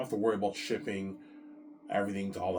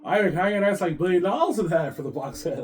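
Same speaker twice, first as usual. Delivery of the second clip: much higher, louder greedy plea; greedy Peter; 9 semitones higher, 9 dB louder than usual. Retold or imitated. Peter begging Ivan for a billion, higher and louder. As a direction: wheedle for a billion, pitched up. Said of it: "he might push for more money".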